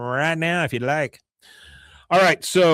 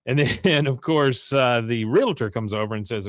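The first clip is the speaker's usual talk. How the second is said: ordinary talk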